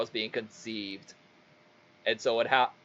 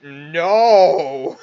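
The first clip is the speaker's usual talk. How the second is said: loud, raised, stretched-out cry; emphatic refusal shout; 4 semitones higher, 11 dB louder than usual. Retold or imitated. Teller stopping friend from kicking ass, loud refusal. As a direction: shout him down with mock alarm.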